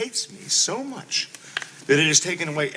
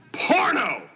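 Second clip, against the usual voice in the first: outraged shout; 10 semitones higher than usual. Acted. Husband finding his wife's racy novel, outraged shout. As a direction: explode in scandal.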